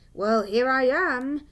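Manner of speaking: a touch of jolly authority, loud and slow; cheerful voice. A narrator's reading. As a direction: announce yourself cheerily, taking your time. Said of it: "said a cheerful voice".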